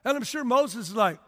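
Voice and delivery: ordinary talk, nothing marked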